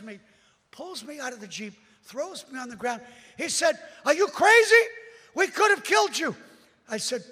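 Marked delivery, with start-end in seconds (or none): 4.0-6.3 high, loud furious scolding; angry sergeant. Retold or imitated. Sergeant yelling at the preacher after the crossing. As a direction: shout at the crazy driver.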